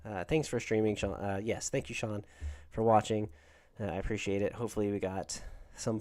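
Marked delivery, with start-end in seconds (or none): none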